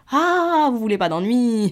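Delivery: loud, high triumphant 'Ah'; mocking retort. Girl throwing the officials' words back at them, mocking. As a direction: pounce on it, high and loud then easing down.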